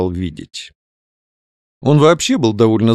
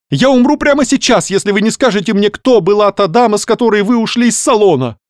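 high, loud, frantic outburst; blurting it out; 11 semitones higher, 4 dB louder than usual. A narrator's reading. Young man demands the lady's name from the doctor, blurting. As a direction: blurt it desperately, voice pitched right up. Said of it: "выпалил юноша".

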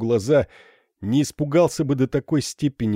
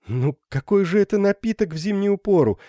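higher, coaxing insistence; insisting; 6 semitones higher than usual. A narrator's reading. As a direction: press her kindly but firmly. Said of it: "настаивала Ударда".

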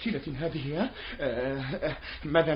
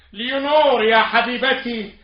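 higher, louder, slow lament; loud slow plea; 7 semitones higher, 7 dB louder than usual. Acted. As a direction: beg the portrait aloud. Pleading to dead wife's portrait. (يناجي زوجته الراحلة بصوت عالٍ بطيء)

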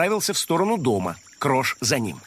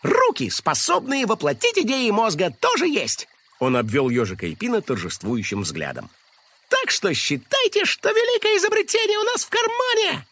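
triumphant; 10 semitones higher than usual. A narrator's reading. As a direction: announce victory proudly. Krosh triumphant.

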